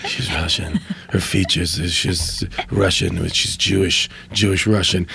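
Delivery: Seagal impression. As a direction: drop low and drag it out like a tough guy. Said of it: "And Seagal's like".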